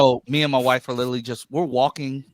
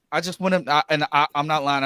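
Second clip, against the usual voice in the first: ordinary talk, nothing marked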